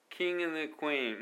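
King and the Queen slow, high and linked. Shown as a demonstration. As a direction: stretch King and the Queen out smoothly. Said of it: "King and the Queenはいける". (高くゆっくりつなげて)

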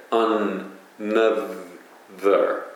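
アンナザー slow, with the n held across both syllables, an-na-zaa; アンナ ザー with the n said twice; doubled n in アンナザー. Shown as a demonstration. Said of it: "アナザーではなくて".

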